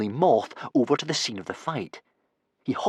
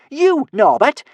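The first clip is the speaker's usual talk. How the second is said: high, loud taunt; teasing; 14 semitones higher, 8 dB louder, slower than usual. A narrator's reading. Kamikaze teasing Norbert mid-fight, high loud taunt. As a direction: tease him loudly, pitched right up. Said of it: "teased Kamikaze".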